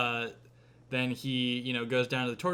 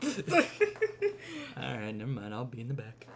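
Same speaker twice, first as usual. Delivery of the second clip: ordinary talk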